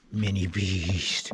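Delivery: very low, very slow beast growl. Acted. Vlogger naming his small chainsaw, low slow beast growl. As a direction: growl it out like a little monster.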